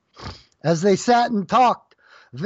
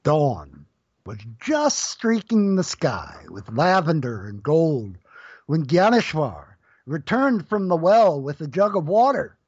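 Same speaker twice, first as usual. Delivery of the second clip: ordinary delivery, nothing marked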